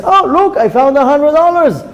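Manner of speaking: high-pitched mock delight; gleeful finder. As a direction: crow over a lucky find. Acts out people pleased with finds.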